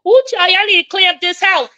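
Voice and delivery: high-pitched mock scolding; quoting own catchphrase. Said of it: "all the time".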